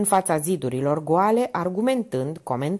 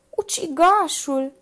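high, slow, dreaded word; slow frightened naming of the murderer; fearful; 11 semitones higher, 4 dB louder, slower than usual. A narrator's reading. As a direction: let the word creep out in dread. Minerva names the killer fearfully.

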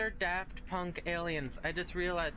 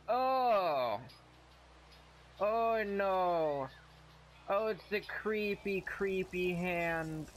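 startled; 3 semitones higher, much slower than usual. Real, not acted. Player spots a creepy hand in the ground, startled cry. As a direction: jump at the sight, oh no.

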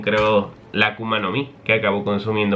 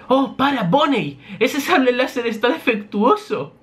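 much higher, nervous scientist voice; Vegapunk pleading; 13 semitones higher than usual. Acted. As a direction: beg her to stop, flustered.

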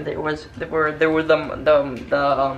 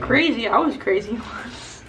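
laughing; 7 semitones higher, 7 dB louder than usual. Pretend. Quoting the crazy meme.

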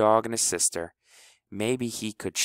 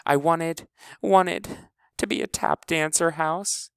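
hiccuping; 9 semitones higher than usual. A narrator's reading. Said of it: "whispered to her brother in a jagged little hiccup".

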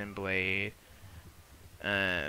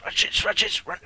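excited hushed urging; 10 semitones higher, 6 dB louder than usual. Real, not acted. Showing off a move.

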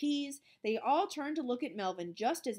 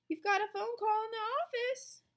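high-pitched voiced teacher; teacher calling out; 12 semitones higher, 4 dB louder than usual. A narrator's reading. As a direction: pass on a message in a high call. Teacher calls out a message.

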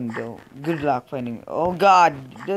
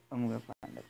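much softer echo of the line; a much softer repeat to himself; muttered to self; 5 semitones lower, 16 dB quieter, faster than usual. Real, not acted. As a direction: say it again under your breath. Repeats the line under breath.

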